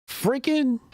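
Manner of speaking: high-pitched scornful echo; incredulous mocking. Streamer scorning the politician's vocabulary, in mock disbelief. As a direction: throw the word back up high in disbelief.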